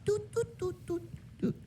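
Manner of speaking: high imitated keyboard notes; keyboard doots. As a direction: tap out high synth doots.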